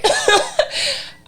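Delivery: high nervous giggle; nervous laugh. Real, not acted. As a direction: giggle helplessly, flustered. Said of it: "that's my nervous laugh".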